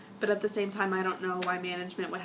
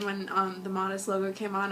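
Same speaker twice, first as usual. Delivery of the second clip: ordinary talk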